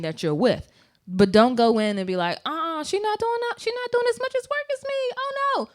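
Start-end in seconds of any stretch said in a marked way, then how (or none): 2.4-5.7 high-pitched, hammed-up protest; mock indignant complaint. Employee griping to the boss about a coworker, mock indignant. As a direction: mimic an aggrieved employee in a much higher voice.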